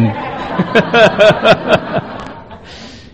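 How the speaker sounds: loud, high burst of laughter; big laugh. Real, not acted. Laughing hard at his own past excuses.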